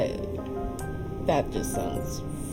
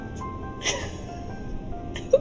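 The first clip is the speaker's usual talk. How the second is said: shrill giggle; high squeaky laugh; 7 dB quieter than usual. Real, not acted. Laughs at Russian voice.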